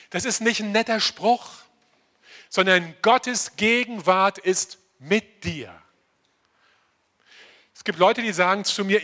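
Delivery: normal talking voice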